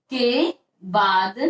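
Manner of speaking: के बाद said very slowly; slow dictation. Shown as a demonstration. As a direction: stretch के बाद out slowly as they write. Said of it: "आप लिखिए के बाद होगा". (धीरे से लिखवाना)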